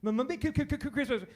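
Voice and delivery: lightly voiced stuttering Santa; a hint of a stuttering Santa. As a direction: stammer out Christmas as a nervous mall Santa. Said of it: "it was a stuttering Santa".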